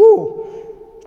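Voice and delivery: high, loud startled ooh; a hammed-up cowering ooh; playful mock surprise; mock shocked ooh. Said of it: "I used to go".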